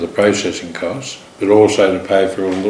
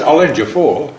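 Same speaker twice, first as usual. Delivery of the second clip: lightly imitated Italian accent, higher and louder; a hint of an Italian accent, offering the loan; 6 semitones higher, 5 dB louder than usual. Teller quoting the Italian backer agreeing to lend four million, hint of Italian accent. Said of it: "He spoke with quite a pronounced Italian accent".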